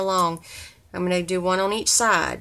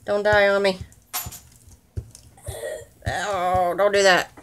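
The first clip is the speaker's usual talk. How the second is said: loud; 2 semitones higher, 7 dB louder than usual. Real, not acted. Pleading with tool, raised voice.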